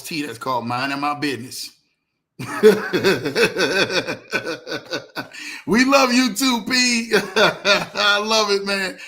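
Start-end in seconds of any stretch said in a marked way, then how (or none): 2.4-5.6 long, rolling real chuckle; hearty laugh. Real, not acted. Host amused by a viewer's comment, long hearty laugh. 5.7-9.0 laughing affection. Real, not acted. Host answering a fond viewer comment, laughing and delighted.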